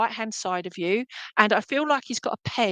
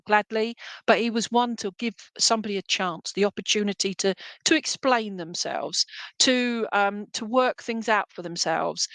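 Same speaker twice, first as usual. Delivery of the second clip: ordinary talk, nothing marked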